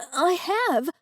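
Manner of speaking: a shaken woman admitting what she heard; sputtered. A narrator's reading. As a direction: blurt it out, shaken. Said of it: "I sputtered".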